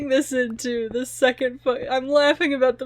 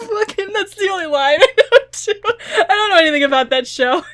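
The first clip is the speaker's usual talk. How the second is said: laughing, higher and louder, quick; tearful laughter running through the words; real giggles pushing the words out; 6 semitones higher, 6 dB louder than usual. Real, not acted. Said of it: "I'm crying".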